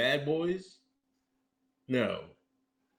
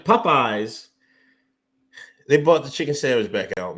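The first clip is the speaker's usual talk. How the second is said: usual speaking voice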